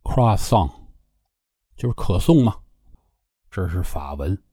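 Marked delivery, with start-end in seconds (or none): 0.0-0.7 Croissant said the French way, as "krwa-san"; Croissant with a French nasal ending; French pronunciation. Shown as a demonstration. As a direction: say Croissant in French, final t silent. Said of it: "那法文怎么念呢".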